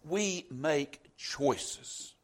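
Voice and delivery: choices drawn out very slow and weighted; slow right down and lean hard on choices; preacher underlining choices; stress on choices. Shown as a demonstration. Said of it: "I want to emphasize the word choice".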